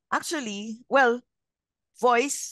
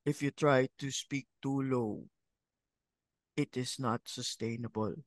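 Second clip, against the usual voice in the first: lower, softer, strained deep voice; forced low voice; 6 semitones lower, 6 dB quieter than usual. Shown as a demonstration. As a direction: push the voice down too low. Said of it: "you cannot lower your voice artificially".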